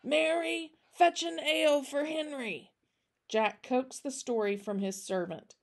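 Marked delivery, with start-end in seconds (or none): 0.0-2.7 raised pitch, calling to another room. Acted. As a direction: call to the servant in the kitchen. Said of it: "He called to the kitchen".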